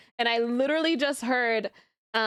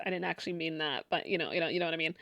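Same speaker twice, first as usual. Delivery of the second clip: much softer, quick and lower apology; sheepish quiet; 8 semitones lower, 7 dB quieter, faster than usual. Real, not acted. Streamer sheepishly apologises to Christians, much softer. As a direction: mumble the apology fast and low.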